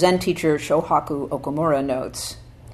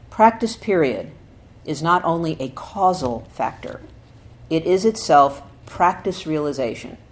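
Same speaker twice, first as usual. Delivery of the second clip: louder, emphatic recitation, rising at the start; solemn scripture reading; 2 semitones higher, 5 dB louder than usual. Acted. Teacher reading Dogen's essay to students, emphatic. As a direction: proclaim the old text with weight.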